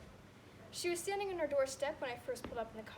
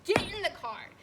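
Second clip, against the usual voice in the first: loud, higher order to get in the car; stern command; 7 semitones higher, 9 dB louder than usual. Retold or imitated. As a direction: bark it at a stubborn parent. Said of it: "We had an absurd showdown".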